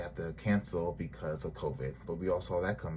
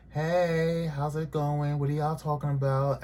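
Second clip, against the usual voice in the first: imitating Jackie's greeting; 6 semitones higher, slower than usual. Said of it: "she's like".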